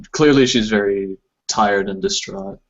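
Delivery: normal talking voice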